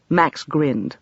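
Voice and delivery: ordinary delivery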